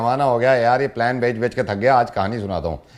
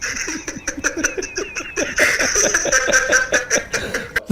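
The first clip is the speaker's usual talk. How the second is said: long laugh; 14 semitones higher, 3 dB quieter than usual. Real, not acted. Laughs at his own punchline.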